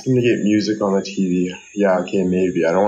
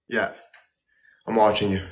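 ordinary talk

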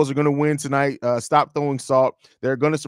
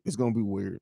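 softer, lower repeat under the breath; muttered echo; 7 semitones lower, 10 dB quieter than usual. Real, not acted. To chat, a muttered echo of his own words. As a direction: mutter it again to yourself.

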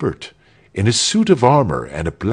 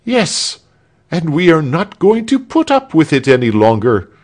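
high, loud outburst of protest; defiant shout; 9 semitones higher, 6 dB louder than usual. A narrator's reading. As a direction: shout your refusal at the tyrant. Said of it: "shouted Arnold of Melchthal".

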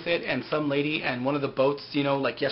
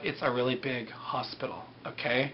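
deadpan dismissal; 4 semitones lower, 8 dB quieter than usual. Retold or imitated. Retold dismissive answer to fate claim.

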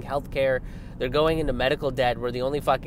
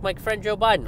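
lightly raised voice, imitating Biden's catchphrase; a hint of Biden saying his stock phrase; mock Biden; 8 semitones higher, 3 dB louder than usual. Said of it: "He's like".

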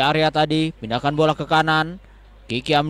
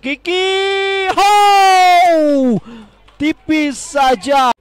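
high, loud shout at a near miss; 12 semitones higher, 6 dB louder, much slower than usual. Real, not acted. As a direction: yell it, stretching tipis slowly.

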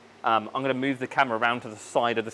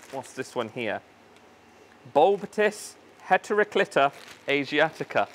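normal talking voice

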